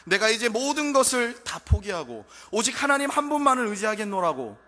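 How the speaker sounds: loud, high, impassioned preaching; preaching at full force. Acted. Preacher crying out to the congregation, full force. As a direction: cry it out to the congregation.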